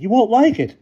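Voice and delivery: high loud warning plea; pleading. A narrator's reading. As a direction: warn her anxiously. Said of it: "Diane Grant pleaded".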